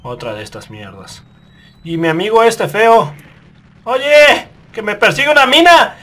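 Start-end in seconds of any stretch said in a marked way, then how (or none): 1.8-6.0 loud, high panicked shout; panicked yelling. Real, not acted. Player calling to his partner as a mine chases him, high panicked shout. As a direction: yell for help as the mine chases you.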